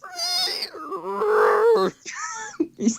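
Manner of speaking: high shrieking laughter; squealing laugh. Real, not acted. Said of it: "You're making me laugh".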